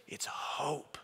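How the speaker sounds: soft Leia reply. Acted. Leia smiling at the soldier, hushed and tender. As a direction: let it fall quietly like a secret. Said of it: "she smiles, and she says".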